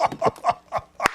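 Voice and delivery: soft, voiceless wheeze of a laugh; breathy silent laugh. Real, not acted. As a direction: laugh under your breath. Laughing at the wife joke.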